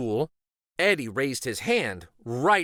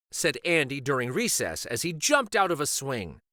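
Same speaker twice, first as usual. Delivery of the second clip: usual speaking voice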